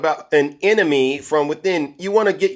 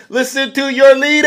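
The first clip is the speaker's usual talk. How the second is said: mock hype intro; 12 semitones higher, 8 dB louder than usual. Real, not acted. Introducing mocking clip of Trump.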